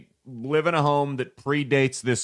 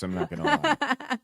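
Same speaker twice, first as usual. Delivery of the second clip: laughing; 9 semitones higher than usual. Real, not acted. Teasing about Nessie pictures.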